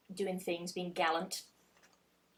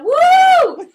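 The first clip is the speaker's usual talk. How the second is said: very high, loud, held whoop; crowd's "woo" cheer; 16 dB louder than usual. Retold or imitated. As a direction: let out a hammed-up crowd whoop. Acted crowd cheer at beheading.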